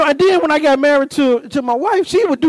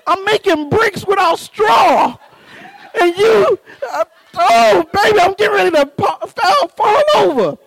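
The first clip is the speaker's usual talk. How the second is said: high, strained, half-crying plea; hammed-up exhaustion; 7 semitones higher than usual. Husband pleading with wife after yard work, dramatically spent. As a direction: wail as if about to collapse.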